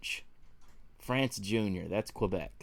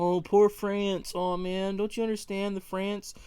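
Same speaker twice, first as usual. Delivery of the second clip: mock offended commenter; 7 semitones higher, 4 dB louder than usual. Mocking commenters who defend France, in a high whiny mock voice. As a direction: whine like an outraged commenter. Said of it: "people like".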